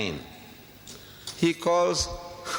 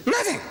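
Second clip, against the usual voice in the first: high, sharp "Nothing" flung out as the punchline; a sudden high-pitched "Nothing" to crush the point; emphatic nothing. Real, not acted. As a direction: land "Nothing" hard and high. Emphatic answer to rhetorical question.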